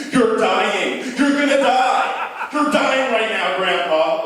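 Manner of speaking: high, loud, frantic shouting; mock panicked yell. Family shouting at high grandpa, frantic and loud. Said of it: "last thing you wanna hear".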